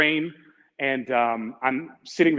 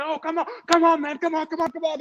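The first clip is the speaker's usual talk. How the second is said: much higher come on, come on; urging stranger; 13 semitones higher than usual. Retold or imitated. Stranger urging traveler to follow, high and pushy. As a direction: imitate a man waving you along.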